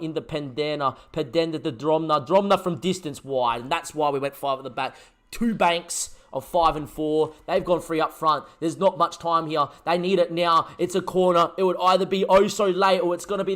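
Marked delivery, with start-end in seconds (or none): none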